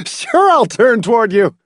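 high, wild laugh spilling into the words; hysterical, high-pitched outburst; wild laughter. A narrator's reading. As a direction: laugh wildly, then call out bitterly. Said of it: "Hamilton laughed wildly".

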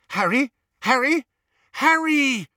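high, loud calls climbing each time; frantic calling. A narrator's reading. Searching the house for Harry, calling out urgently.

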